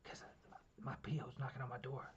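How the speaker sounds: retold hushed, low phone voice; whispering. Telling a friend his P O is knocking, hushed. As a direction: drop your voice as if someone is outside. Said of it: "Why are you whispering".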